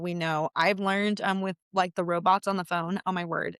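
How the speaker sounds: ordinary delivery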